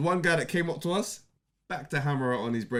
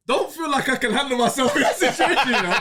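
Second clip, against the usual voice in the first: panicked inner voice; 8 semitones higher, 5 dB louder than usual. Retold or imitated. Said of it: "I'm thinking to myself".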